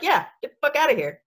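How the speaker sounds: hammed-up standing your ground; mock defiance. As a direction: lightly act out shooing a cat. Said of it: "there's an attitude adjustment".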